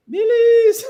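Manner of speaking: very high, loud vendor cry; street vendor's call. Retold or imitated. Mealie seller heard outside on arrival, high hollered imitation. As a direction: imitate a hawker hollering wares. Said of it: "you hear the odd".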